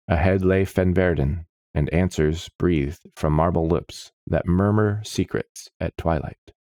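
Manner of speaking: ordinary delivery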